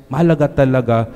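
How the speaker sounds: normal talking voice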